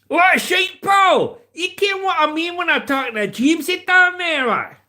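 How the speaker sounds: high, loud Scottish shout; Scottish accent. Acted. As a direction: holler like an outraged comic Scot. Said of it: "Hot as Hell here is Scottish".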